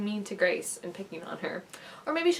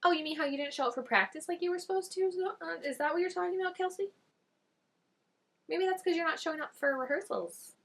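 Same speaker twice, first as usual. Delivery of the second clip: higher, mocking sarcastic comeback; sarcastic retort; 9 semitones higher than usual. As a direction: needle her with fake sweetness. Talking back to Kelsey on screen.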